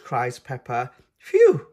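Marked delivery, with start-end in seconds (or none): 1.2-1.7 relieved phew. A narrator's reading. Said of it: "says Mummy Pig".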